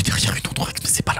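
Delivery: whispered letdown; hushed, deflated aside; disappointed whisper. Real, not acted. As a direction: mutter it under your breath. Checking the next card.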